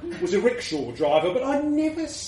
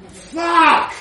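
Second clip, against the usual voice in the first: astonished exclamation; 9 semitones higher, 7 dB louder than usual. Acted. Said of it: "I said".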